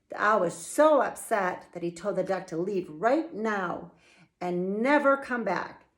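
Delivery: usual speaking voice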